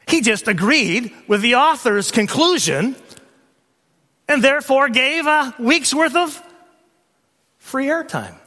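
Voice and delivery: high, forceful sermon delivery; preacher at full force; impassioned preaching. Real, not acted.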